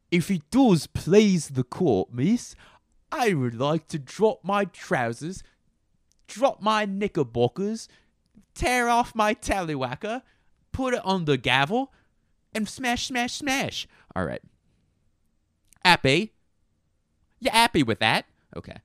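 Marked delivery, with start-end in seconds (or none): 0.1-13.9 very high, hammed-up British voice; Yorkshire accent. Addressing the court absurdly, high mock Yorkshire. 15.8-18.2 high, loud dropped-H British; Yorkshire accent. Acted. Challenging the listener, high loud Yorkshire accent.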